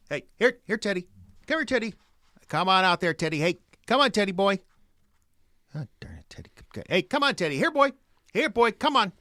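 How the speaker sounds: high, loud calls for a dog; calling a lost pet. Acted. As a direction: call the dog out from the shed.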